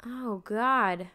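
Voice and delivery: ordinary delivery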